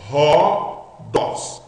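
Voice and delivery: "Rodós" said with an aspirated h, like English house; rough breathing as h. Shown as a demonstration. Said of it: "colocando um H do inglês na frente". ("Rodós" com H aspirado do inglês)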